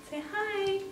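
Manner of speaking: high, soft coo at a puppy. Real, not acted. Coo it softly up high like baby talk.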